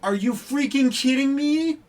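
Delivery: high, loud rising shout; incredulous shout. Real, not acted. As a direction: yell the question in real outrage. Disbelief boils over into a shout.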